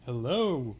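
loud, exuberant greeting; yelled hello. Real, not acted. Co-host opens the recording, yelling hello. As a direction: belt out a big hello. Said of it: "don't yell and wake up the baby".

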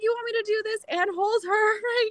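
high, laughing disbelief; mock outrage with a laugh; incredulous laughing. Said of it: "I'm like".

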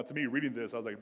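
normal talking voice

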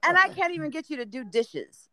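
high-pitched parental rant, retold for laughs; mock scolding. Parent comparing his son to hardworking kids, mock scolding. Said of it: "yell at my son".

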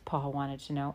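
usual speaking voice

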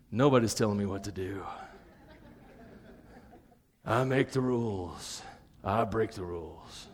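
low, hushed voice of the heart; defiant inner voice. Acted. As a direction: keep it low and quiet, like a secret thought. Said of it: "in your heart".

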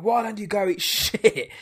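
imitating a mother screaming at her son, breaking into a laugh; mock scream. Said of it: "she screams it".

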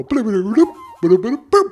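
high, loud squeaky blub-blub; silly fish blubbing. Acted. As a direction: burble like an excited little fish. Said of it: "Kevin would pop up ... and go".

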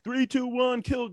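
high-pitched put-on kid commando; mock battle countdown. Teen paintballer starting the game, mock high-pitched countdown. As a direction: count down high, imitating an over-keen kid.

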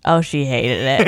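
low, slow aside; knowing dismay. Retold or imitated. Viewer reacts to bride's spin, low slow dismay. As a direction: drop down into a drawn-out realization. Retold pitying realization.

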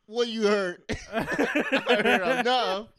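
loud, high indignant lines; loud high mock outrage. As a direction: bark it back indignant. Acted bar exchange, shouted.

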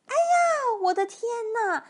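put-on high, startled old-lady outcry; high-pitched "oh my goodness" panic; frightened cry. A narrator's reading. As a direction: shriek in mock terror, storybook style. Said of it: "那个瘦小的老妇人喊起来".

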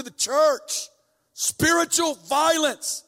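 loud, high preaching that keeps building; fiery preaching. Acted. Preacher urging the church to tear their hearts, fiery preaching. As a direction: preach it hard, pushing the congregation.

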